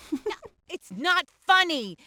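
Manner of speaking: ordinary talk, nothing marked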